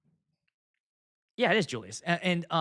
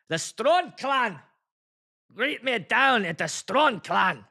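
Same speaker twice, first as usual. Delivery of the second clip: loud, slow mock Scottish brogue; high, drawn-out Scottish accent; 5 semitones higher, 4 dB louder, slower than usual. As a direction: proclaim it in a put-on Scottish accent. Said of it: "That sounds Scottish".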